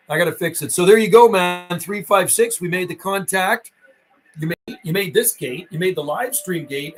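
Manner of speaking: ordinary talk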